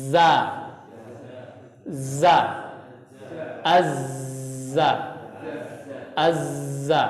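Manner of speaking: buzzing za and azza with held z; demonstrated za. Shown as a demonstration. Teacher modelling za for students, buzzing z. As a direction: let the z hiss like a bee. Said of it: "kemudian lafadzkan".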